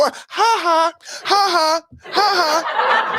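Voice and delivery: high taunting ha ha; sing-song jeering laugh; mocking laugh.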